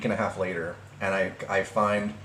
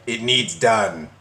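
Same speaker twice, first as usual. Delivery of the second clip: slow, punched mock demand; mocking quote; slower than usual. Ridiculing the ex's odd wording, heavy slow mockery. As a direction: repeat it heavily, word by word. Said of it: "Who talks like that anymore?".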